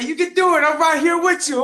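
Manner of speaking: high, lightly mocking coach voice; uncle cheering him on. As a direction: imitate the uncle's hype encouragement. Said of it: "He's like".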